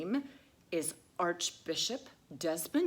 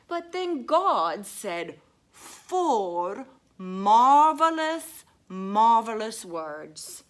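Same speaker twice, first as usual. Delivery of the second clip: slow, loud, grand proclamation; dramatic reading; 6 semitones higher, 11 dB louder, much slower than usual. Acted. Reading God's words to children, slow and grand. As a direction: draw out each word majestically.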